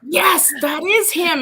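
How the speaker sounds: loud, high, delighted retold reply; excited. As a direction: burst out imitating a thrilled client. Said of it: "he's so excited to hear".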